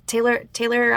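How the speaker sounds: ordinary talk, nothing marked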